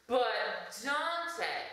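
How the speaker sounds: high, drawn-out put-on voice; imagined viewer interrupting. An imagined viewer with a mask problem, high mock plea. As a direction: pipe up high as a pestering viewer. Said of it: "I hear you say".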